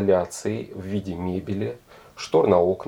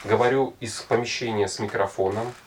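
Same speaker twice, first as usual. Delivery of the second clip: usual speaking voice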